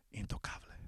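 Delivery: hushed dramatic whisper of the nickname. Acted. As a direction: breathe the word out, unvoiced.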